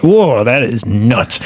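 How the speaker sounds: slow, drawn-out amazed whoa; stunned whoa. Retold or imitated. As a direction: stretch it out in disbelief. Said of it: "you're just like".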